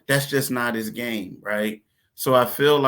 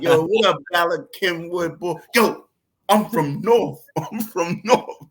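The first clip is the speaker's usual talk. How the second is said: higher, louder swaggering tough guy; mock tough guy boast; 6 semitones higher, 5 dB louder than usual. Teasing his cousin's boyfriend, swaggering tough guy. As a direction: puff up like a street kid.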